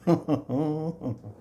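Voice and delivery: a real, high burst of laughter; own laugh. Real, not acted. Teacher laughing at his own karma story to the class. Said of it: "that laugh of mine is horrible".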